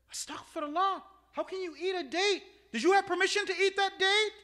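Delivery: high, loud outrage building to a shout; scandalized scolding. Acted. Khawarij rebuking a comrade for eating a date, scandalized.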